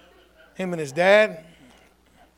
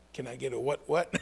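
soft call for response; 4 semitones lower, 10 dB quieter than usual. Real, not acted. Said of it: "I had to say that".

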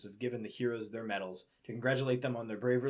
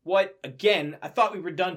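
exasperated surprise; 7 semitones higher, 7 dB louder than usual. Acted. Twilight dismayed at intruders.

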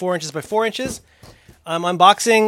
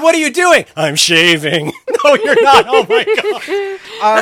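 laughing, louder mock shock; laughing disbelief; 6 semitones higher, 4 dB louder, slower than usual. Pretending to catch partner sculpting in toilet, laughing. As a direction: play the stunned roommate through laughter. Acted scene of walking in.

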